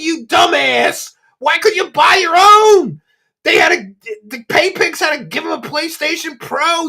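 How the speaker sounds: high-pitched, loud, outraged yelling; incredulous rant. Real, not acted. Ranting at a streamer over a gifted PC, incredulous yelling. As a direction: yell it in disbelief, voice pushed way up. Mocks streamer's free gaming PC.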